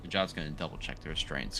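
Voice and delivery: ordinary talk, nothing marked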